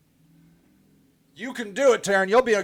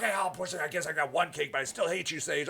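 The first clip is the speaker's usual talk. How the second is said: softer, grudging mumble; grudging client; 4 semitones lower, 9 dB quieter, faster than usual. Retold or imitated. Client grudgingly agreeing to the marketer, softer and sulky. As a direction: give in sulkily. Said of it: "they're like".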